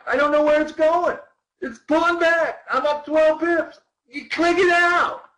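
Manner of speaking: mock the trader who panics and bails; panicky trader. Coach mocking amateur traders who bail early, high and loud.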